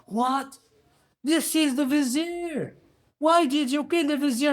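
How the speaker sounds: high-pitched mock scream; horrified scream. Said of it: "would scream".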